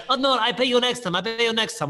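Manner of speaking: high mock Russian accent; imitated Russian brushing off a debt; Russian guy. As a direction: dodge payment in a put-on Russian accent. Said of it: "And he's like".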